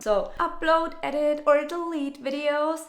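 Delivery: a hint of a snide sorority girl. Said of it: "this toxic sorority girl voice".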